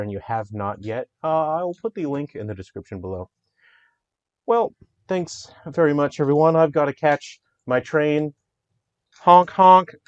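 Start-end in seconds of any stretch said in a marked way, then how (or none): 4.5-8.3 hammed-up goodbye voice. Developer signing off to fans, silly high voice. Hammy farewell before catching train. 9.2-9.9 loud high honk-honk like a horn; mock train honk. Developer pretending his train arrives, loud honking.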